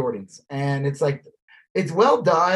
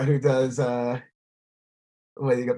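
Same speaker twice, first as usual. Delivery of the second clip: usual speaking voice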